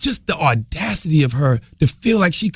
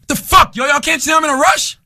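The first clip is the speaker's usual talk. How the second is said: loud, high, exasperated shout; impatient yell; 10 semitones higher, 4 dB louder than usual. Retold or imitated. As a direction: snap at them to hurry up. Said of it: "And the guy goes".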